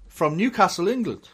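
normal talking voice

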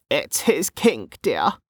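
put-on slow, knowing old woman. A narrator's reading. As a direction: draw it out, sure you know the secret. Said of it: "said Gemma knowingly".

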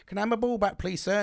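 a lightly voiced little boy asking nicely; child begging grumpy neighbour for his ball; polite child.

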